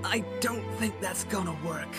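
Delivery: low, soft and weakened; quiet, drained reply; dying murmur. Acted. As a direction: answer faintly from your deathbed. Dying hero answers.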